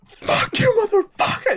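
high-pitched retold scream at a heckler; imitating a comic's furious outburst; losing it. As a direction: explode into a loud, shrieked mock rage. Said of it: "lose your shit on them".

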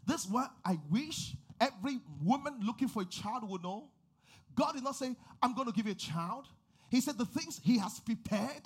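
loud, high, rising preacher's cry over music; preaching at full force. Acted. Pastor preaching to hopeful women in the church, loud and soaring. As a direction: lift the church with each line.

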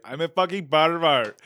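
hammed-up Nepalese accent, loud; mock Nepalese accent blurted out; loud Nepalese-accent punchline; Subas impression. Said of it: "I was doing it on the podcast".

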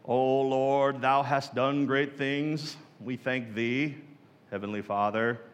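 hammed-up pious King James voice; King James prayer. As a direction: intone it like a stiff church prayer. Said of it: "if we pray in the King James Version".

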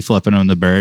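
ordinary talk, nothing marked

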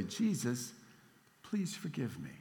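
put-on soft, small voice of a three-year-old girl, echoing her brother; squeaky little girl. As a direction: mildly imitate a tiny girl repeating a prayer. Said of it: "her squeaky three-year-old voice".

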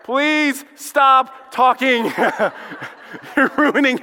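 slow, laughing plea to stop. Retold or imitated. Dad laughing, begging the pastor to stop talking. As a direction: beg him to stop through a laugh. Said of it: "Nathan was like".

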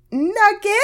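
high, teasing nickname call; mock pet name. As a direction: squeak the nickname.

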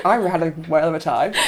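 ordinary talk, nothing marked

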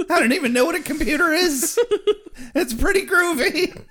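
high, squealing through laughter; helpless high giggling voice; giggly mock confession.

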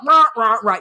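loud playful rah rah of a scolding mom; hammed-up scolding noises; mock angry ranting. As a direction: bluster like a fake-mad parent. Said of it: "And I'm like".

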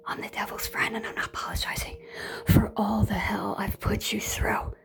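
taunting devilish whisper; sinister whisper. Retold or imitated. Voicing a narc taunting the empath in a whisper. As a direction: hiss it like the devil's friend.

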